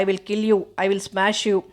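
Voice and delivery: usual speaking voice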